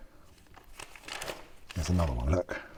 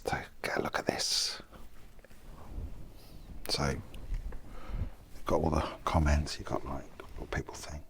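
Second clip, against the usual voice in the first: whispering; 5 semitones lower, 9 dB quieter than usual. Real, not acted. Quiet talk in a church.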